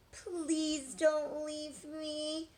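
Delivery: slow, high pleading lamb; implored. A narrator's reading. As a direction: drag it out, begging him to stay. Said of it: "the lamb implored".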